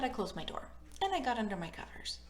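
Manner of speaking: ordinary delivery, nothing marked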